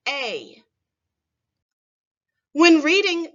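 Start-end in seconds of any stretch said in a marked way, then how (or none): 0.1-0.6 a said as in day; long a. Shown as a demonstration. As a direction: sound out a as in they. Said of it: "actually pronounced".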